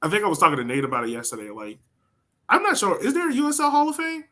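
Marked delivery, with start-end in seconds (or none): none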